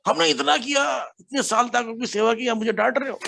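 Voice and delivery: mock aggrieved complaint. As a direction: parody a self-pitying devotee feeling wronged. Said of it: "हमारा humility दूसरा होता है".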